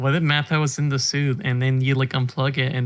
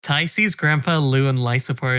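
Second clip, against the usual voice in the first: normal talking voice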